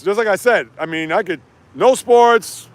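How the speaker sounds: usual speaking voice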